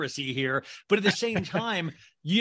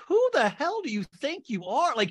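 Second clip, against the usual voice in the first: indignant mock outrage; 7 semitones higher, 6 dB louder than usual. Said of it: "And I'm like".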